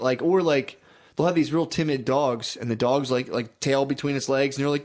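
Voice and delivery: ordinary delivery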